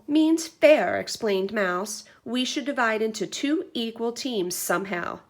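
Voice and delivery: put-on lively read-aloud for kids; story narration. A narrator's reading. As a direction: read to the class with energy. Teacher reads picture book aloud.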